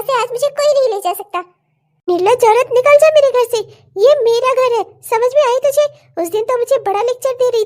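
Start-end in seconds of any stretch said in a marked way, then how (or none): 2.1-5.8 louder, throwing her out of the house; furious wife ordering rival out; angry shout. Acted. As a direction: yell at the shameless woman.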